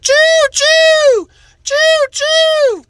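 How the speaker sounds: very high, loud choo choo like a toy train whistle; train whistle call. Acted. Kid playing train again, high whistle call.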